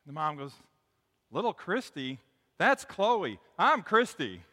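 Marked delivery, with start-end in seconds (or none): 1.3-4.4 imitating a frazzled mom, climbing higher and louder; exasperated correction. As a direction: land the punchline as an indignant mom setting someone straight. Mom corrects mistaken stranger, punchline.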